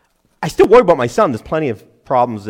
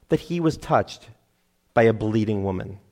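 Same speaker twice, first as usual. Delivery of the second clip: ordinary talk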